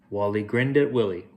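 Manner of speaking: ordinary delivery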